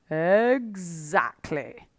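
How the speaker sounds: the fox savouring the moment; satisfied Mister Fox. A narrator's reading. Fox confirms slowly.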